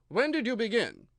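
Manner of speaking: a king scolding his subject; loud reproving royal voice; stern king. A narrator's reading.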